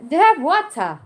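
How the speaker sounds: playful try at British English; British accent. Retold or imitated. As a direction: put on a British accent, dropping the R. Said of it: "teach me the British accent".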